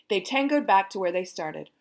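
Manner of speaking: usual speaking voice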